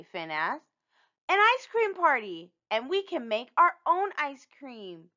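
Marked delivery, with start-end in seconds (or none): 1.3-5.1 bright and bubbly, much higher; excited idea. Acted. Rosa pitching her party idea to friends, excited. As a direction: burst out with the idea.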